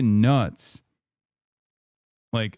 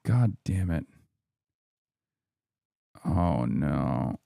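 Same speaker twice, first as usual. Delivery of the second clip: low, deflated groan of words; real low-voiced dismay; dejected cursing; 9 semitones lower, 4 dB quieter, slower than usual. Real, not acted. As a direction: sink into quiet defeat. Stream setup keeps failing.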